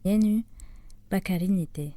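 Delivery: measured, ceremonial poetry reading in Japanese; poem recital. Acted. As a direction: recite the waka, lifting and holding each phrase.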